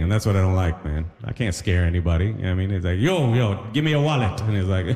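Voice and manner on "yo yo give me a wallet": a hint of Trini accent, higher, not scary; mild Trini mugger. Mugger in a Trini accent, unthreatening. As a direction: play a Trini mugger who scares no one. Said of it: "The Trini accent isn't intimidating".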